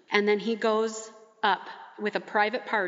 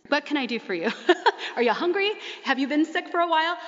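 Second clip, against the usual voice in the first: lightly mimicked mother-in-law, higher, with a laugh; practical mother-in-law; 5 semitones higher, faster than usual. Likened to Peter's mother-in-law tending the healed girl, practical and fussing. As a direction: fuss over a patient in a raised voice. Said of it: "just like Peter's very practical mother-in-law".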